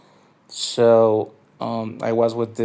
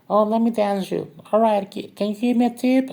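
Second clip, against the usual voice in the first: much higher coaxing voice; mock dancer voice; 10 semitones higher, 3 dB louder, faster than usual. The narrator mimics the girl at his table asking for tips, high mock voice. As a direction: play the dancer begging for a tip, high.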